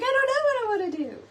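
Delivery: high, quick squeak of indecision; hammed-up flustered self, words tumbling out high; mock dithering. Designer retells her own indecision to viewers, high and hurried. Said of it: "Then I was like".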